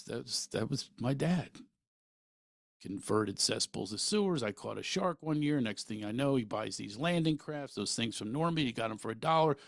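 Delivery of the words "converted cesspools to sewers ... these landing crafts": usual speaking voice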